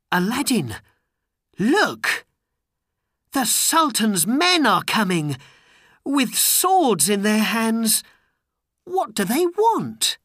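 high, loud alarmed call; urgent warning. A narrator's reading. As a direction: cry out a warning to a friend. Friend warns of armed men.

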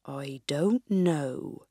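slow, low and hushed sage; wise old sage. Acted. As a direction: draw it out, secretive and grave. Wise man answers.

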